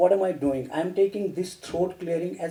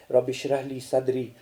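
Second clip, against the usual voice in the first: recited prayer; 5 semitones lower, 5 dB quieter than usual. Shown as a demonstration. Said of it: "That's a wonderful prayer".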